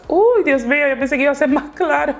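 sudden real surprise; surprised gasp. Real, not acted. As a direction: caught off guard by how dark it is. Shocked at the dark shade.

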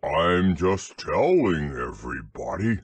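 low, slow, ponderous donkey; deep, drawn-out grumble; grumbling Eeyore. A narrator's reading. Donkey tells everybody his view, low slow grumble.

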